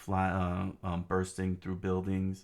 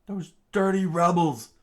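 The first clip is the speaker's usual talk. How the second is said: high, loud curse at the enemy; angry soldier; 10 semitones higher, 10 dB louder than usual. Acted. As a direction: spit the accusation out loudly. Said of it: "one of the soldiers said".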